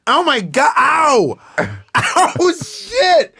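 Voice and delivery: high-pitched mock yelp; hammed-up panic and hurt; pretend pain. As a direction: cry out as if just shot. Acting out being shot.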